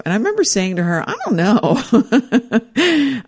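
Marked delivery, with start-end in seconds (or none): 1.1-3.3 high, slow laughing "I don't know". Retold or imitated. Answering her sister with a slow high laugh.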